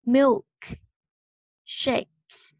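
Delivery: Milk, long pause, Shakes; slow, split syllables. Shown as a demonstration. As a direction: model Milk Shakes in two separate parts. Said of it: "Các bạn nghe cái âm của cô này".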